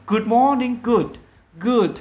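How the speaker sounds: "Good" with its final d clearly closed; teacher's careful "Good morning", ending marked; stressed final sound. Shown as a demonstration. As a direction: lean a little on the end of "Good". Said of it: "Nhớ nhấn âm cuối một xíu".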